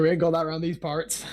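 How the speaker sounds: ordinary talk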